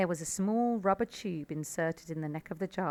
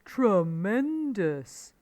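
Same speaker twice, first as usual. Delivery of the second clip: slow, louder exclamation from Badger; mild shout; 3 semitones higher, 6 dB louder, much slower than usual. A narrator's reading. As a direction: draw it out in a hearty half-shout. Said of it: "shouted Badger".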